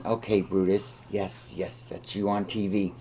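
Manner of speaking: gentle, hushed words to a dog; soft pet talk. Real, not acted. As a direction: coo quietly at the dog.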